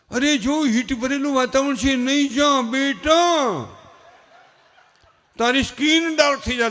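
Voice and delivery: loud, high, drawn-out pleading of an overprotective mother; mother begging her child to stay in, hammed up; doting mother. Mother stopping son going out, loud coddling plea.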